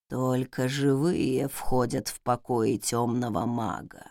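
low, slow creaking otherworldly voice; eldritch horror. A narrator's reading. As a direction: creak it out from beyond the grave. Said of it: "проскрипел потусторонний голос".

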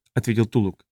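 ordinary delivery

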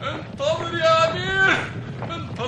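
high pleading call to the prince. Acted. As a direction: shout to stop the march. Jurist shouting to stop the army.

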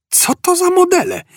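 very high-pitched incredulous question; astounded. A narrator's reading. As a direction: squeak it out in astonishment. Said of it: "Fordowi oczy prawie wyszły z orbit". (piskliwe pytanie z osłupieniem)

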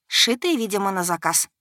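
ordinary delivery

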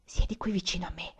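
faint whispered invitation; barely audible whisper. A narrator's reading. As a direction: hardly voice it at all. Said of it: "con un soffio di voce appena percettibile".

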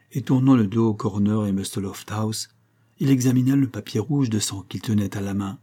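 usual speaking voice

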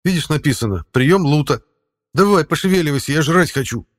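high, loud cat voice. Acted. Cat speaks in story.

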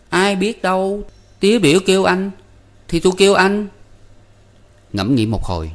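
put-on a boy shrugging it off; boy Năm. A narrator's reading.

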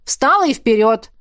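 loud, brisk order; calling out. Acted. Coach sending trainee back on course, loud command. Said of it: "выкрикнул он".

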